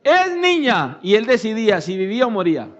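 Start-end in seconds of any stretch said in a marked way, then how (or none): 0.0-1.0 calling out. Retold or imitated. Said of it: "le decían al padre".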